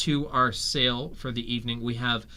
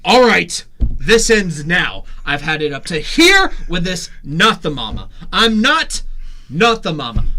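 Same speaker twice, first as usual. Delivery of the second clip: stern dad; 4 semitones higher, 7 dB louder than usual. Acted. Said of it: "dad character scolding baby".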